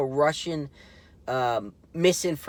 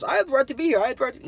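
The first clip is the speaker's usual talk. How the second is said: mocking impression; 9 semitones higher, much faster than usual. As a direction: parody him in a high, squeaky, self-righteous voice. Mocking the activist's words.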